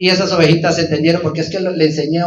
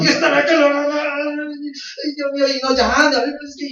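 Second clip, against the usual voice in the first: anguished parent; 9 semitones higher, much slower than usual. Acted. Worried parents finding lost son.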